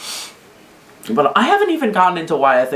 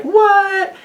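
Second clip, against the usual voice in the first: very high, louder mock gasp of a word; hammed-up disbelief, pitched way up; shocked Susie; 4 dB louder than usual. Susie hearing the knight's betrayal, shocked and high. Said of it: "Susie's gonna be like".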